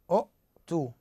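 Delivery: "أؤتوا" as u'-too, short u cut by a glottal stop; original hamza form. Shown as a demonstration. Teacher showing the word's origin, "أؤتوا" with a glottal stop. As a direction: break "أؤتوا" after the first vowel with a catch. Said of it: "همزة ساكنة".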